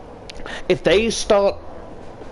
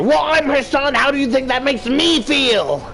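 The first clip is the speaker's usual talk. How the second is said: very high, hammed-up protest; indignant outburst; 12 semitones higher than usual. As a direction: argue back with the voice pushed way up. Acted self-defence in retold argument.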